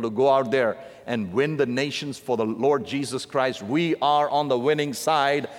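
usual speaking voice

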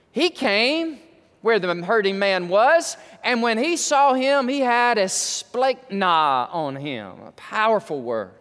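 high, fervent sermon delivery; emphatic, impassioned pulpit voice; fervent preaching. Real, not acted. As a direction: preach it with stretched key words. Preaches at full force.

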